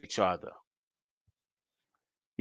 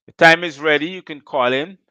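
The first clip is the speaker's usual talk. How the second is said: ordinary talk, nothing marked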